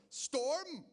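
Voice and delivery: slow, overblown announcer voice; hammed-up CNN headline; dramatic news anchor. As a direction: stretch it out like breaking news. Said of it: "this is what they called the storm".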